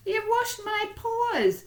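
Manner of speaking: very high, slow dog voice, stunned; drawn-out high squeak of dismay. Acted. Dog stunned after the mouse mopped his paws, slow and high.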